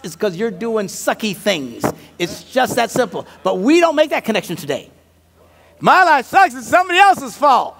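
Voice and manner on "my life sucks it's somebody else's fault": high, loud self-pitying complaint; hammed-up grievance; mock whining. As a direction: moan like someone blaming everybody else. Mimics people blaming others.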